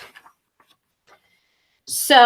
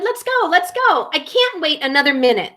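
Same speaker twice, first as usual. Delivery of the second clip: impatient iguana; 5 semitones higher than usual. Acted.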